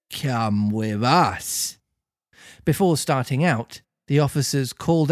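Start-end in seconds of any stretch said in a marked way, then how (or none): 0.1-1.8 officer cuts Pinocchio off firmly; slow firm order. Acted. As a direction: spell it out word by word.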